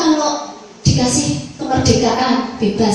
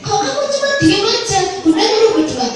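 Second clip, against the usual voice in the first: higher mock teacher scolding a silent child; 6 semitones higher than usual. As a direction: play the puzzled teacher, pitched up.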